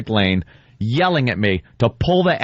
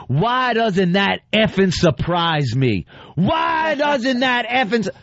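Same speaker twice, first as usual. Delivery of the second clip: slow, higher mock shout; cop impression; 8 semitones higher, slower than usual. Officer losing his mind at the driver, slow mock shout. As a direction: hammer out the officer's outrage slowly. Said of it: "I'm doing an impression".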